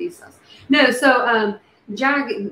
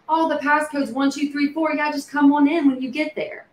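high, breezy imitation of a host; breezy acted invitation; 7 semitones higher than usual. Imagined host giving out the house passcode, high breezy invitation. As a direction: hand out the code casually, pitched well up. Voicing someone sharing the door code.

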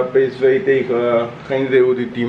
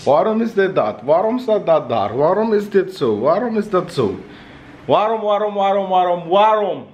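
higher, nagging whine of endless questions; mock complaining girlfriend; 6 semitones higher than usual. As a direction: imitate her whining, building up. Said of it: "is ze alleen maar klagen".

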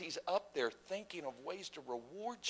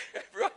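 short laugh; 9 dB louder than usual. Real, not acted. Laughs mid-sermon.

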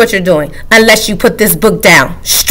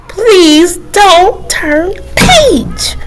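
very slow, higher plea; 10 semitones higher, much slower than usual. Acted. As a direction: draw each word out. Book pleads slowly.

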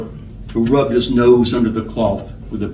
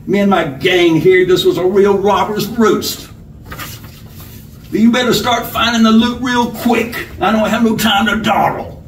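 higher-pitched robber character voice; bossy outlaw giving orders; bullying robber; 7 semitones higher than usual. A narrator's reading. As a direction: announce the hold-up like you own the room. Robber threatening the room.

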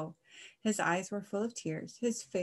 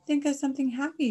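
high, loud, quick encouragement; sweetly amused; 7 semitones higher, 8 dB louder, faster than usual. A narrator's reading. Miss Duck advises Mister Cow to think happy, sweetly amused. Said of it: "sweetly amused".

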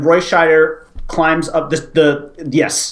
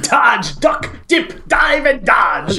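coach shout; 6 semitones higher, much slower than usual. Retold or imitated.

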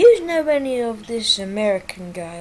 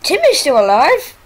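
loud, high cheering on; root for him like it's a race; yelling go, Dad, go at the screen; excited cheering; 7 semitones higher, 9 dB louder than usual. Real, not acted. Cheering character on in game.

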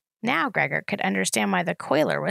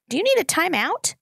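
stern scolding; 11 semitones higher, 3 dB louder than usual. A narrator's reading. Scolding toddler sister.

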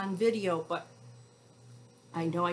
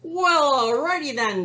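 high, loud, falling back down; relieved cowboy; 8 semitones higher, 9 dB louder than usual. A narrator's reading. Cowboy agrees to sing for the wolf, loud high agreement. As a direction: agree heartily as a storybook cowboy. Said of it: "the cowboy says".

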